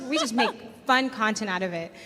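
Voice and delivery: ordinary talk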